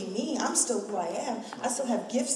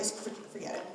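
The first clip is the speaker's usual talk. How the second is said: whispered aside; 10 dB quieter than usual. Real, not acted. Dismissive aside after listing costs.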